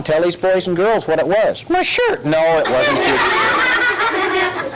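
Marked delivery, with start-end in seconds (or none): 1.7-2.2 high silly puppet answer. Acted. Dummy answers the officer, high puppet voice. 2.6-4.8 slow correction through a laugh. Acted. Officer corrects the dummy, slow laughing correction.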